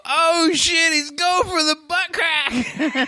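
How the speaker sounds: very high squealed warning; retold panicked cry. Storyteller reliving the lizard's dive for Bob's pants, high squeal. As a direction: cry it out in mock horror.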